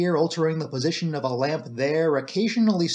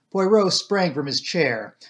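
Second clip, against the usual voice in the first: plain reading voice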